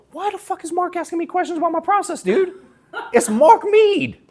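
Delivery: freaking out to a friend about a star's call; panicked disbelief. Retold or imitated. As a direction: squeal it in total disbelief. Panicking to Mike about Mark.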